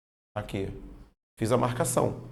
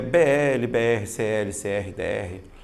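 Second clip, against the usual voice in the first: slow letter-by-letter spelling; 3 semitones lower, slower than usual. Shown as a demonstration. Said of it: "consoante mais L O R?".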